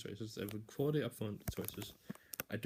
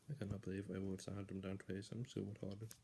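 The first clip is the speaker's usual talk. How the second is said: much softer, muttered to himself; self-reproach; 2 semitones lower, 9 dB quieter than usual. Real, not acted. Scolding himself for a repeated slip, muttered. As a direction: grumble at yourself quietly.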